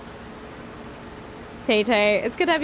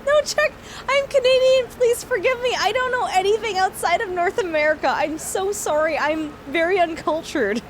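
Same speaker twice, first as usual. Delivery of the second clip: embarrassed apology; 6 semitones higher than usual. Real, not acted. Streamer apologizing to Czech viewer, flustered laughing. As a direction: beg forgiveness through giggles.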